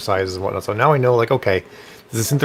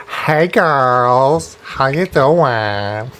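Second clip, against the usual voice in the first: sassy drag queen; 2 semitones higher, 4 dB louder, much slower than usual. Pretend. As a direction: drag queen from Brooklyn, slow drawl. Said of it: "my sixty-year-old drag queen from Brooklyn".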